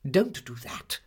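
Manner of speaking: high-pitched, bossy scolding; no-nonsense aunt voice; brisk Miss Betsy. Acted. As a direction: order the child about briskly.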